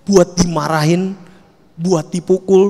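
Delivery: ordinary talk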